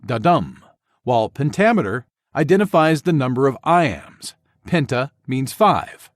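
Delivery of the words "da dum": "da-dum" with a light "da" and a stressed "dum", pitched lower; iambic stress demo. Shown as a demonstration. Teacher showing students an iamb, stress on "dum". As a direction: tap out "da-dum" as one iamb. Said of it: "Iambic identifies how the syllables are stressed".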